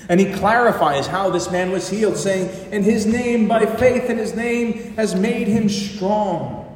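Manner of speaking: loud, high, ringing proclamation; impassioned preaching. Real, not acted. Preacher quotes Peter on the healed man, impassioned preaching. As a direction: proclaim it at full preaching force.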